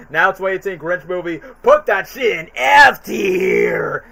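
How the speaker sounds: hammed-up angry commenter, slow and shouted at the end; mock hater comment. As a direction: play the furious troll in the comments. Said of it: "we're definitely gonna see the hate comments".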